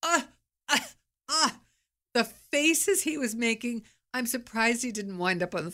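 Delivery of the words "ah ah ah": tags 1. Retold or imitated. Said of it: "He's like".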